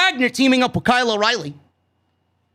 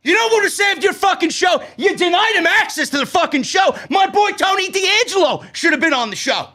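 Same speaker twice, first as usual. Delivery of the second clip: mock angry rant; 7 semitones higher than usual. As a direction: yell it like you're telling her off to her face. Ranting at show host in mock address.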